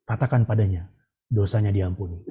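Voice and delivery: low, measured divine instruction; voice of God. Retold or imitated. God telling the preacher she is forgiven, low imitated voice. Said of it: "Tuhan bilang".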